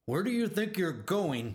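shouting. A narrator's reading. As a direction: call after him sharply. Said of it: "shouted the coach".

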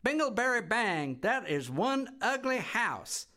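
put-on high, loud, giggling kid voice; a cheeky little girl laughing at the house; giggly bratty girl. A narrator's reading. Girl giggling at her new home, high and cheeky. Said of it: "she giggled loudly".

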